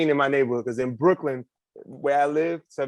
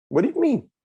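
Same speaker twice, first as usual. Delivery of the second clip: surprised; 13 semitones higher, 6 dB louder than usual. Acted. Kid asking, puzzled.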